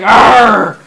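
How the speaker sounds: loud pirate captain's "arr"; pirate growl. Acted. Pirate captain answering a panicked crewmate, pirate growl.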